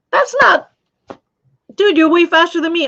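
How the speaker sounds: high, loud disbelief at a friend's speed; real excited protest; amazed outburst. Real, not acted. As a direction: blurt it out, impressed. Amazed by a viewer's knitting speed.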